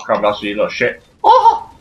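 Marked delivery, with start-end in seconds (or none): 1.2-1.7 high startled "oh"; startled yelp. Real, not acted. Gamer under fire, high startled yelp.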